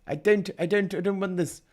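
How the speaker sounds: reluctant high whine; whiny reluctance. Real, not acted. Dreading the climate article.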